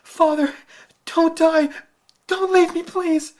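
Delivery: very high, loud anguished cry; grief-stricken child crying out. Acted. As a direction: wail over the dying father. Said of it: "cried into the blood-stained feathers".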